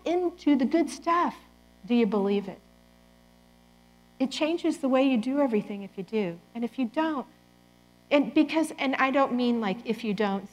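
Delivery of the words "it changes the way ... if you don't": ordinary talk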